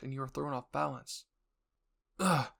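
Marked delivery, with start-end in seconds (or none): 2.2-2.5 grunt. Acted. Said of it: "you grunted again".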